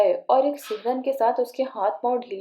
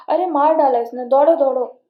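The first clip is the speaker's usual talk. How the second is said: loud high cry for help; panicked yell; 3 semitones higher, 7 dB louder than usual. A narrator's reading. Old man yelling for help in fright. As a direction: yell that he is being killed.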